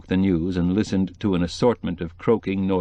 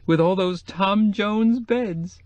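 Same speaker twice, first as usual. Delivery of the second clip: much higher, slow amused squeal; shrieking with laughter; 13 semitones higher, much slower than usual. A narrator's reading. Cokie laughing to Quill about the Tom Jones beds, high shriek. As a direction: stretch it out in a gleeful shriek. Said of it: "she shrieked".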